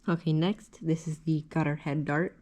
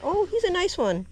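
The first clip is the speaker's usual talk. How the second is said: excited; 14 semitones higher, 4 dB louder than usual. Real, not acted. Fighting a big fish.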